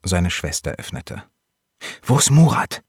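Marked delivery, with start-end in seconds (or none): 2.0-2.8 high, loud, urgent question; urgent demand. Acted. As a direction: call out for your brother urgently. Boy urgently seeking his brother. (drängend und laut gerufen)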